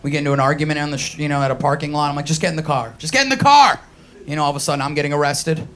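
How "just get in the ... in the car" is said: growing anger, ending in a loud shout; exasperated yell. Retold or imitated. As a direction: snap at her to get in, rising to a yell. Parking lot argument.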